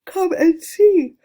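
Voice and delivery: high, loud, slow joyful call; delighted cry. Acted. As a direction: draw it out like a happy summons. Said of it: "almost dancing with delight".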